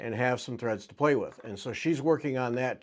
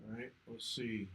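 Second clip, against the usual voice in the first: faint, far-off headset mic; in a tunnel; 10 dB quieter, slower than usual. Real, not acted. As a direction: sounds like talking from a tunnel. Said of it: "you sound like you're in a tunnel".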